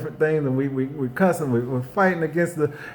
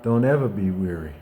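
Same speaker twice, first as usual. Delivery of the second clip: gentle reassurance; 6 semitones lower, 4 dB quieter than usual. Retold or imitated. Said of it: "He said".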